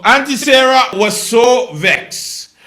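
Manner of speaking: hammed-up storyteller voice; character voice. Shown as a demonstration. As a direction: draw it out like a comic character. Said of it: "all my voices sound like Stewart".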